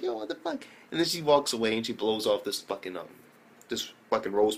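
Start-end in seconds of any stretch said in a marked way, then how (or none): 0.0-0.7 squeaky high, hushed disbelief; shocked reaction. Retold or imitated. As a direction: yelp it up high, barely voiced. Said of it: "And I'm like".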